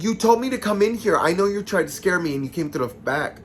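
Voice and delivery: acted-out heated complaint; indignant accusation. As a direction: start high and loud, accusing friends. Retold accusation of friends.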